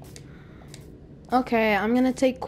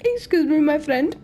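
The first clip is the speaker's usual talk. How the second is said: higher-pitched mock Indian accent; 6 semitones higher, 4 dB louder than usual. Interrupting a friend in a joking Indian accent. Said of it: "Like in an Indian accent".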